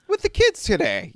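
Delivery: high squeaky mock voice. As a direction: squeak it out, teasing. Jokey old-man comment on youth.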